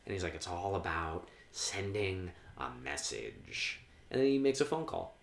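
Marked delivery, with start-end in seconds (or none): 0.3-3.8 slow, low, quiet villain voice; Joker imitation. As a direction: draw it out softly like a menacing villain. Acting out Joker's line.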